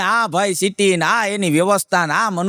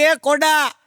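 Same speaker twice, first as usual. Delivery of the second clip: higher, slower exasperated host voice; Manojbhai's retort; 10 semitones higher, slower than usual. Retold or imitated. Host Manojbhai telling his guest it's the soap box, high exasperated voice. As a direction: put on a mocking high voice to scold the fool.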